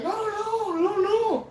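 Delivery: high, soft, drawn-out string of no's; mock high-voiced protest; denying seller, imitated. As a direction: pipe it up high as the other guy. Retold seller's hasty denial.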